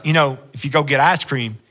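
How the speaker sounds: normal talking voice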